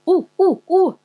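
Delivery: high, loud monkey hoots; imitated chimp laughter in short whoops. A narrator's reading. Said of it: "laughs the monkey".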